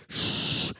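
loud hissed rush of air; imitated whoosh. Preacher imitating spirits leaving heaven, whooshing. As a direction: make a spirit shoot past.

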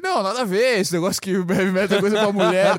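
loud, indignant forum guy voice; mock chat intruder. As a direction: barge in as a know-it-all newcomer. Random guy barging into chat.

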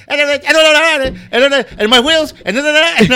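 very high, loud stammering caricature; imitated mom's voice. Host mocks comic's stage act, high frantic mom voice. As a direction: squeal out garbled repeated words. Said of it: "he would do his mom's voice".

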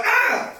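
short, high yelp as if stabbed; quick high-pitched "ah"; mock cry of pain. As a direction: yelp at a sudden jab, hammed-up. Acts getting stabbed in film.

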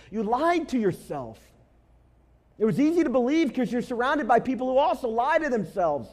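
high, loud preaching with every line driven hard; impassioned sermon peak, loud and high; full-force preaching. Acted. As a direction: preach it out to the whole room at full volume.

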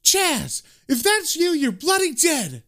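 high-pitched wifely shout, slowing on the threat; angry wife. Acted. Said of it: "we just hear Chaz's wife say".

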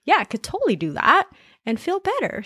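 sudden cheerful realization; mock eager voice.

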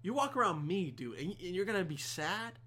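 ordinary talk, nothing marked

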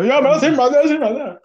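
loud raspy crackhead impression; twitchy slurred sniffing addict. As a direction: act out a jittery crackhead. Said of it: "he got that crackhead, uh, voice to him".

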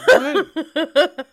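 loud, high-pitched baffled question; imitating a bewildered bystander; confused. Cab driver called someone's dad, confused. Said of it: "that man was so confused".

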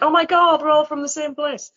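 high, imitated amazement; excited surprise. Retelling tourists finding fellow Brisbane people, excited surprise. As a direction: mimic excited tourists, pitched well up.

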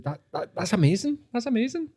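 high, soft, gushing voice; gushing fan. Retold or imitated. Host imitating the woman gushing over the studio. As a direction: gush like a starstruck fan. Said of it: "she was like".

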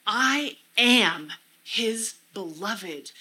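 I AM drawn out, then a measured HIS BELOVED; slow emphatic affirmation. Shown as a demonstration. Speaker meditating on a verse, slow emphatic words. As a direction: weigh each word, slowly, leaning on AM. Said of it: "emphasizing different words to really get that".

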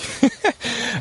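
quick high amused laugh; a brief real laugh; short laugh. Real, not acted. Laughs at own enthusiasm.

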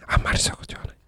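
hushed whisper, barely voiced. Real, not acted. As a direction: drop to a secret whisper. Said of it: "小声で言うのやめて".

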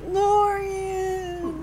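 high, crying call of the name; distressed cry. Shown as a demonstration. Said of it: "I read it as, like, distressing".

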